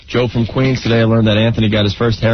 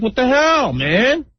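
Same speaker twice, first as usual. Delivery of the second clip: higher-pitched exasperated outburst; mock pissed off; 10 semitones higher, slower than usual. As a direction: act out being fed up with a buddy. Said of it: "you just get pissed? You're like".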